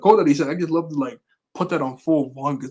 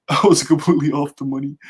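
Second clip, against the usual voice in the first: laughing at his own mistake; amused retake while laughing; 7 dB louder than usual. Real, not acted. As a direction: say it through a laugh, louder. Repeats the same line laughing.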